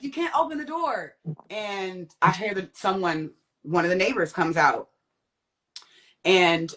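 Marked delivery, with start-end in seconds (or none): 0.0-1.2 high retold urgent warning; urgent protest. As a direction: insist in a pitched-up voice, keeping the volume contained. Retold plea to stop man.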